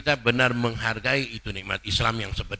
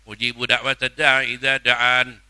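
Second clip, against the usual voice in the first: recitation; 9 dB louder than usual. Acted. Quran recitation.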